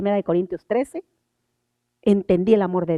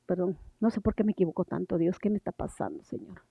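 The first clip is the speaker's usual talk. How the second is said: much softer, lower, apologetic mutter; flustered murmured aside; 4 semitones lower, 12 dB quieter than usual. Real, not acted. Preacher asks God what is wrong after a slip, murmured. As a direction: drop to a quiet, puzzled plea.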